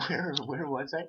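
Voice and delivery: ordinary delivery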